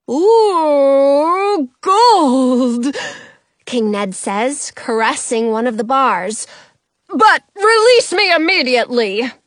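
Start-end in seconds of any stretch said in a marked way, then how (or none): 0.1-3.0 loud, drawn-out gloating coo; greedy awe. A narrator's reading. The king strokes his golden bars, cooing greedily. 7.1-9.3 loud, high royal order; indignant demand. A narrator's reading. The trapped king orders his captor, loud and indignant.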